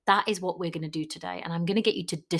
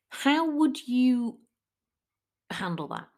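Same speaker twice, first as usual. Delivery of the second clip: normal talking voice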